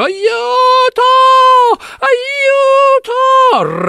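very high, loud tearful cry for help; desperate crying for help. Acted. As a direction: scream for help as the monster nears. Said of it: "gridava disperata".

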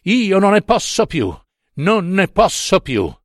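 high, falling cry of despair; desperate breakdown. Acted.

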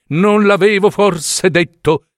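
a hint of self-pitying needle; needle says I told you. A narrator's reading. Broken needle complains to the fingers, smug. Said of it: "disse l'ago".

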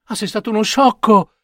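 high exasperated mother cry; impatient mother. Acted. Impatient mother scolds Babino, high-pitched. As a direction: scold him, fed up. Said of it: "gridò la madre spazientita".

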